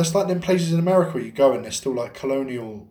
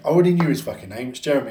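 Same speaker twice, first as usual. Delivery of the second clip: ordinary delivery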